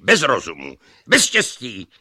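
rising from loud to a high yell; building shout. Acted. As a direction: escalate into shouting.

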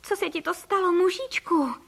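high-pitched, alarmed question; alarmed wife. A narrator's reading. Wife shocked at shrunken husband. (vyděšená manželka, vysokým hlasem)

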